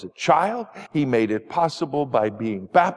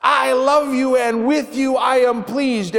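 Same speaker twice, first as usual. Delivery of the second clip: joyful God voice; 9 semitones higher, 3 dB louder than usual. Pretend. God praising Jesus, retold excitedly.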